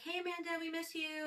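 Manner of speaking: high, sing-song greeting; cheery phone greeting. Retold or imitated. Friends on a boat greeting Amanda on the phone, high and cheery. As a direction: chirp it like friends on a boat calling.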